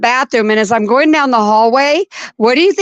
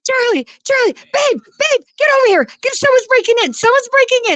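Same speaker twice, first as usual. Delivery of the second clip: high, frantic cries for her husband; panicked screaming; 13 semitones higher than usual. Retold or imitated. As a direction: call out in a panic, voice way up. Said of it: "I start screaming".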